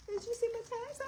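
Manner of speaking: high, giggly teasing voice; high teasing kid voice; teasing son impression. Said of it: "he thinks he's so funny".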